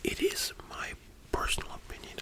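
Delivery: normal talking voice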